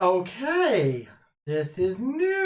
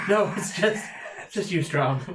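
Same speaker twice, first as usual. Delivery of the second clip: ordinary talk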